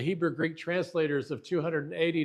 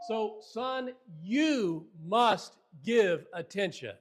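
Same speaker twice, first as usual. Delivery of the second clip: slow, higher, each word of "you must give attention" set apart; emphatic fatherly charge; 4 semitones higher, slower than usual. Acted. Solomon charging his son to listen, emphatic and slow. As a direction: lean hard and long on "you" and "must". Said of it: "that Solomon is saying".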